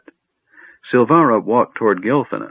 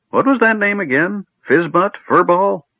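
much higher, puzzled doddering voice; forgetful old wizard; 12 semitones higher, 3 dB louder than usual. A narrator's reading. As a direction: fumble the name like a befuddled old man.

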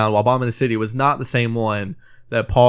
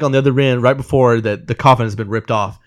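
usual speaking voice

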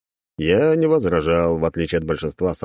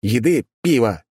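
higher, irritated call; angry shout; 4 semitones higher, 3 dB louder than usual. A narrator's reading. Adventurer demanding food and beer, angry shout. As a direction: snap out the order crossly. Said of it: "злобно крикнул я".

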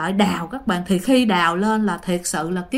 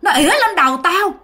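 high-pitched indignant ghost complaint; aggrieved spirit; 7 semitones higher, 3 dB louder than usual. Retold or imitated. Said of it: "vong đó mới trả lời". (giọng vong oán trách, cao)

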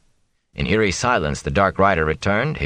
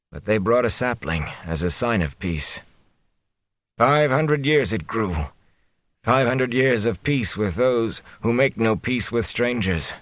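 feverish muttering; 4 semitones higher than usual. A narrator's reading. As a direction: drift through it, half in a fever. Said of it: "He faded off into muttering".

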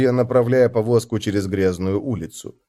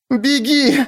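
high, strained cry; groaned shout; 13 semitones higher than usual. A narrator's reading. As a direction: urge him to flee. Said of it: "простонала она".

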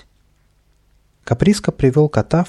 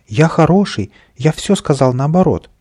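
high, raised protest; frightened; 5 semitones higher, 5 dB louder than usual. A narrator's reading. Little man begs off from the sword, frightened. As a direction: protest your innocence in an urgent high voice. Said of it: "совсем испугался Каприска".

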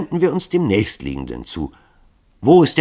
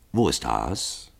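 monoton; 6 semitones lower, 5 dB quieter, slower than usual. Acted. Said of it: "monotonen, gleichmäßigen Stimme".

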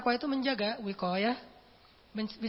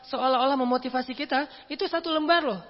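usual speaking voice

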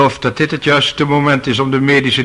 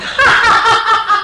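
high mocking laugh from the bench; 5 dB louder than usual. Judge laughing at the witness's claim. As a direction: laugh her off.

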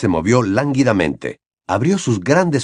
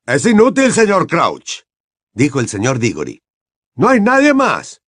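higher, firm colleague's voice; lightly voiced Mr Diggory; 5 semitones higher, 3 dB louder than usual. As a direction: Mr Diggory telling Crouch no one else is there.

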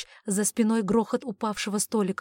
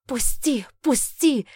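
raised, urgent cries; desperate demand for release; struggling demand; 5 semitones higher, 6 dB louder, slower than usual. Acted. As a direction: cry out while fighting to get loose. Struggling to break free.

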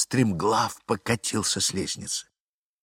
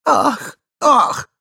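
alarmed gasp; 9 dB louder than usual. Acted. Husband gasps in alarm.

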